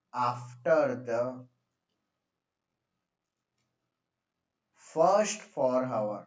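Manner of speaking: "after the first four hour" very slow and low; writing-speed delivery with "after the" held long; slow dictation. Real, not acted. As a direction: dictate word by word, drawing out "first".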